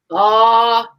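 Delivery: a big, loud, drawn-out aww from the crowd. Retold or imitated. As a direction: belt out an aww like a moved chaperone. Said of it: "As loud as you could possibly hear".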